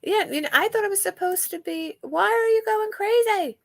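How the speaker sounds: high pet-talk, asking why it's going crazy. Real, not acted. Keeps talking to pet.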